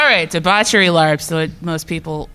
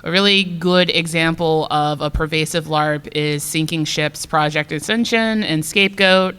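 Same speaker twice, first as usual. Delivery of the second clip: usual speaking voice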